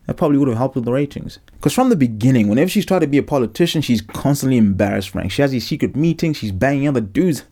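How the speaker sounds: ordinary delivery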